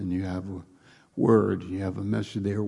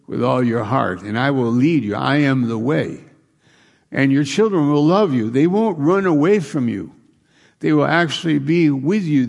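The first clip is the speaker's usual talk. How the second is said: higher, louder climactic sermon voice; full-force preaching; 5 semitones higher, 9 dB louder than usual. Acted. Preacher voicing God's promise to the congregation, full-force preaching. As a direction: build the preaching to a peak.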